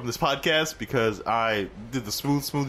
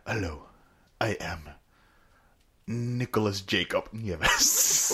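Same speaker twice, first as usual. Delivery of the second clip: put-on Spanish accent; 5 semitones lower, much slower than usual. Host showing off to co-host, low slow Spanish accent. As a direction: introduce yourself with a suave Spanish accent. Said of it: "this is my Spanish".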